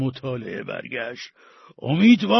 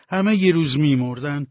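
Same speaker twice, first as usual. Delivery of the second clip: ordinary delivery, nothing marked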